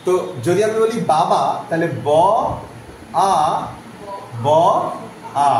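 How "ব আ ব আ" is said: "ব, আ, ব, আ" with a long gap after each; sound-by-sound breakdown. Shown as a demonstration. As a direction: break it into separate "ব, আ, ব, আ". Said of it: "এই যে ভেঙে তোমাদের বোঝালাম".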